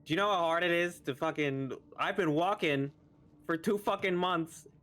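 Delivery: high, exasperated tirade; angry rant. Acted. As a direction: vent every grievance at once. Halfling rants at drunken patron.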